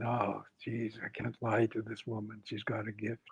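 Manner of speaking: inner thought. Retold or imitated. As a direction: think it to yourself, very softly. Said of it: "In my mind now, I was saying".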